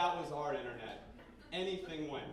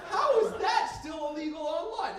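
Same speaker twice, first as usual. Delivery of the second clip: very high, loud indignant yell; outraged rant; 12 semitones higher, 9 dB louder than usual. Acted. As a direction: shriek the question in comic outrage. Comic rant, repeats his outrage.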